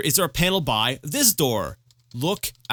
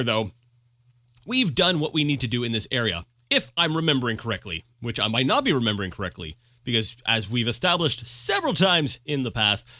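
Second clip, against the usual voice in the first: usual speaking voice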